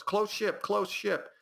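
high-pitched alarm; urgent lookout call. Shown as a demonstration. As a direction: call out a nearby threat to the crew. Demonstrating an urgent in-game warning.

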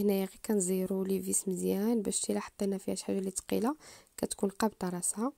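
ordinary talk, nothing marked

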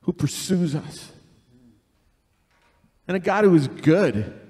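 earnest spoken prayer. Acted. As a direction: pray it over the church. Leads a prayer.

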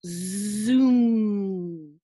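long loud steady "Zoom"; lawnmower zoom. Shown as a demonstration. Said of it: "It goes like this".

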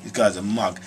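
low, quiet scornful sneer; muttered mockery of onlookers; lightly voiced low mockery; mocking onlookers. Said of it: "they laugh at you and say".

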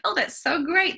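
high bright praise; excited. Retold or imitated. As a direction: cheer the child on, pitched high. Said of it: "I was like".

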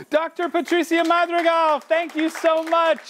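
high, slow, drawn-out thank you; delighted thanks. Real, not acted. Host thanking a donor by name, delighted. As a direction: savor each word of thanks.

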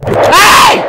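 very high, very loud shriek; startled scream. Real, not acted. Player hit by a jump scare, startled scream.

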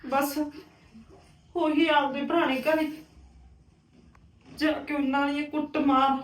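soft, halting confession after crying; subdued sorrow. Acted. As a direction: confide the old hurt quietly, with long pauses. Upset aunt confides after crying.

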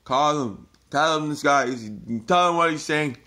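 imitating an old man's alarmed shouting; retold yelling. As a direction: yell for the police like an outraged old man. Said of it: "he started yelling".

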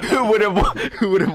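laughing mid-sentence. Real, not acted. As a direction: laugh through the words. Laughing at own pitch.

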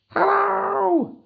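very high, loud tiny voice; cheeky high little greeting; gingerbread man voice. Acted. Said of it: "he said".

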